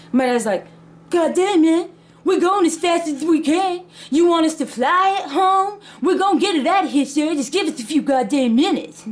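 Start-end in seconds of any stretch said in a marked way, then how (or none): 1.1-9.1 high-pitched, indignant imitation of dad; acted exasperated comeback. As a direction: protest being rushed, voice pitched up. Dad snaps back at impatient seller.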